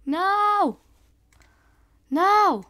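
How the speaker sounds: loud dismayed cry of No; frustrated shout. Real, not acted. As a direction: yell it out in frustration. Frustrated outburst at game loss.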